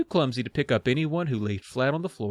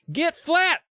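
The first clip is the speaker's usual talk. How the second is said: screaming; 14 semitones higher than usual. Acted. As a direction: scream it at the kids. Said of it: "I screamed to the kids".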